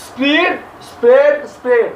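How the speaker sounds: স্প্রিড with ee, then স্প্রেড twice with short e; changed vowel. Shown as a demonstration. Teacher showing verb forms, vowel changing from স্প্রিড to স্প্রেড. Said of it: "উচ্চারণটা আলাদা".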